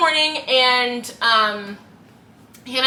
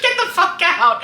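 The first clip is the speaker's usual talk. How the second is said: loud, slow, higher laughing order; mock yell; 8 semitones higher, 5 dB louder, slower than usual. Retelling roommate ordering the guy out, mock yell. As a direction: throw him out, half laughing. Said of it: "And he's like".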